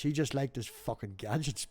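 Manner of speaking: usual speaking voice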